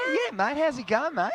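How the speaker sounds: high mock Aussie greeting; light Australian accent. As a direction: chirp it like an Aussie mate. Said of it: "supposed to be talking in an Australian accent".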